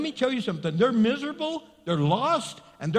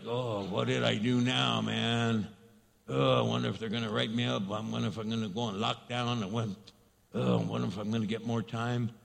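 low, soft worried muttering to yourself; worried inmate mutter; 10 semitones lower, 5 dB quieter than usual. Retold or imitated. An inmate fretting in his cell when his name is called, low and soft. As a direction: fret under your breath like an inmate called out.

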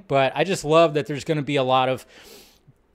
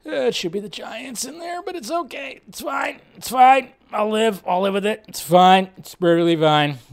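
pitched-up strained sarcastic reassurance; mock it's fine; 7 semitones higher than usual. Giants fan forcing calm after the loss, high strained sarcasm. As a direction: insist it's fine while clearly not. Coping as his Giants lose.

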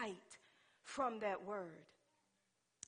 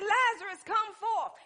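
high, loud, compact summons; urgent command; 10 semitones higher, 14 dB louder than usual. Acted. Preacher retelling the summons to Lazarus, high loud command. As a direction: fire the call out without stretching it. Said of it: "So he said".

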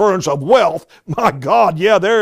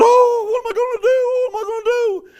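squeaky, much higher panicked wail; mock frantic worrier, very high and squealing. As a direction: flap like a headless chicken.

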